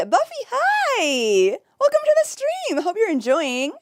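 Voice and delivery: high, slow, laughing hi; delighted greeting. Real, not acted. Streamer welcomes Buffy with a high, laughing hello.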